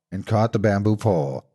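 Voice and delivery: plain reading voice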